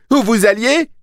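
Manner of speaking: very high, loud demand; absolute rage. A narrator's reading. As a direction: yell the question in fury. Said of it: "Il était dans une rage absolue".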